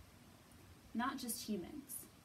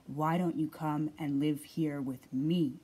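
low, slow growl; growling Bear; 7 semitones lower, 7 dB louder than usual. A narrator's reading. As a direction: rumble it out like a big bear. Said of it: "growled Bear".

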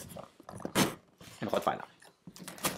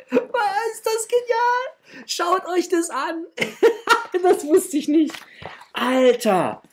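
much higher, slow, laughing delight; thrilled; much slower than usual. Real, not acted. Vlogger to viewers over the surprise poster, giddy high laughing. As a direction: squeal it out in giddy disbelief.

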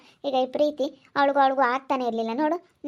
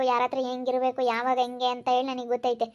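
ordinary delivery

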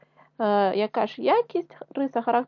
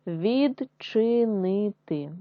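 відчинити drawn out slowly syllable by syllable; slow syllable reading; much slower than usual. Shown as a demonstration. Teacher sounding out відчинити for pupils, slow. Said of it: "читаємо з вами слова".

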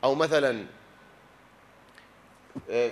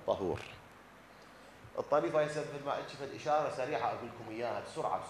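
usual speaking voice

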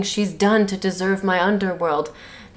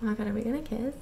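hushed mock thought; 13 dB quieter, much faster than usual. Bella's mock inner thought.

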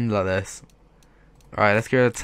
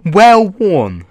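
Well worn said louder and higher in disbelief; exasperated repeat; 8 semitones higher, 12 dB louder than usual. Real, not acted. Annoyed at the trade-up, repeating Well worn loudly. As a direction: spit out Well worn in outrage. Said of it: "It's even trolling me".

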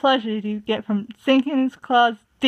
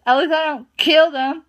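louder, cutting jab; hissing; 5 semitones higher, 4 dB louder than usual. A narrator's reading. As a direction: hiss a cruel jab. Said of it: "Tall Paw hissed".

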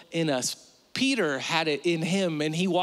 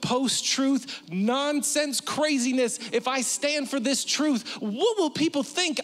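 climbing steadily higher into panic; panicking inner voice; 8 semitones higher than usual. Retold or imitated. Preacher voicing mounting dread, pitch climbing. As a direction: build the fear, each word higher.

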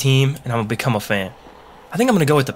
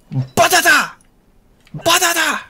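goofy cry; slower than usual. Pretend. Shouting weapon name playfully.

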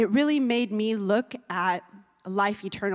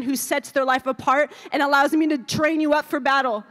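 loud, high, impassioned preaching; rally the congregation, building in force; preaching at full force; 7 semitones higher, 5 dB louder than usual. Real, not acted. Preacher urging the congregation to keep running, impassioned loud preaching.

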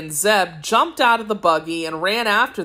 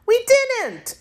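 put-on much higher girl voice, firm denial; a little girl insisting, pitched high; high girl's voice, flatly refusing the charge. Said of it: "declared the girl".

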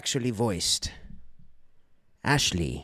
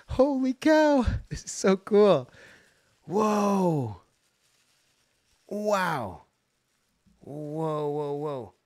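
thrilled; 8 semitones higher, 6 dB louder, slower than usual. Real, not acted. Thrilled unboxing a gift.

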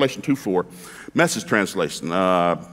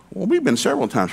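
bluffing husband; 8 semitones higher than usual. Retold or imitated.

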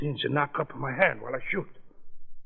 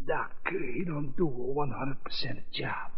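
fading into a whisper; dying gangster barely getting the words out; weakening voice. Acted. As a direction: voice failing to a whisper. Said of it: "Your voice is getting weaker".

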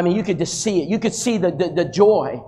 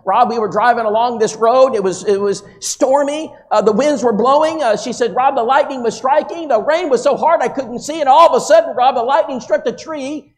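higher, louder breathless telling, building to the crash; Sheila's dramatic story; 7 semitones higher, 4 dB louder than usual. Retold or imitated. Sheila telling the preacher her storm story, rising excitement. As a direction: build the suspense higher and louder as the tree falls. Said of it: "And I said".

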